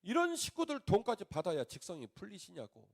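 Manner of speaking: angry protest. Retold or imitated. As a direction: hurl it at God in anger. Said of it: "막 열받아가지고".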